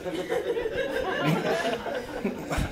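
breathless silent wheeze of laughter; wheezing laugh. Real, not acted. Comedian cracks up at his dad's plight, silent wheezing laugh. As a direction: laugh with no voice, doubled over. Comic laughing at own story.

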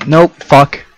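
loud panicked cursing; loud swearing. Real, not acted. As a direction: shout the curses. Being chased, cursing.

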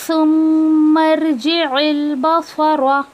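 सुम्मर्जिइल बसर drawn out slowly; slow recitation. Shown as a demonstration. Teacher recites the phrase for students.

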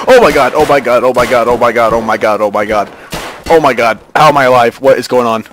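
loud, frantic disbelief; panicked, repetitive loud exclaiming. Real, not acted. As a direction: blurt it out in shaken shock. Just survived attack, fleeing.

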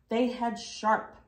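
usual speaking voice

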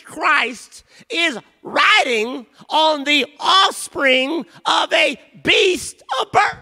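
loud, high preaching, slowing the key words; shouted sermon climax; full-force preaching. Acted. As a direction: preach it out to the whole church.

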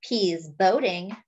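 ordinary delivery

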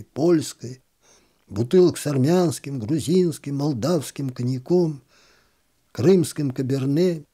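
usual speaking voice

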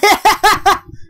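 high burst of laughter; real cackle; laughing. Real, not acted. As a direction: crack up laughing.

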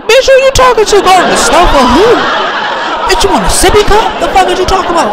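high-pitched outraged rant; exaggerated indignant yell at a child; mock cussing out. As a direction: cuss out the bratty kid in a shrill squeal. Acted rant at bratty niece.

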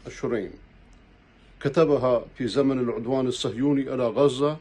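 usual speaking voice

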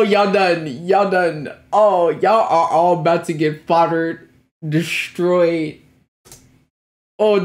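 ordinary talk

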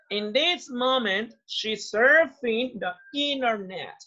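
slow, clear classroom read; slow model sentence. Shown as a demonstration. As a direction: model it slowly for learners. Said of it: "teacher models sentence slowly". (frase modelo lenta)

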